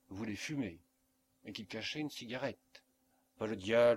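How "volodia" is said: louder alarmed call of his name; mother frightened for her son, calling his name. Acted. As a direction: call him sharply, scared of what he's hiding. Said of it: "d'une voix effrayée".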